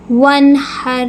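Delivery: وانحر with a clear ن, no nasal hold; clear nun. Shown as a demonstration. Teacher models وانحر for students. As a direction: say وانحر crisply. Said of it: "نون کے اوپر ساکین ہے".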